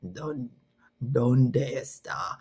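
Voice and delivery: slow, hushed Spanish lesson man; low-key, drawn-out language-app man; Duolingo man voice. Retold or imitated. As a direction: imitate the creepy man from Spanish audio lessons. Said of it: "one is a man".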